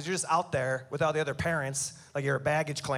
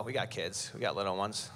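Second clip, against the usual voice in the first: hushed aside; 9 semitones lower, 6 dB quieter than usual. Real, not acted. Sudden low hushed aside.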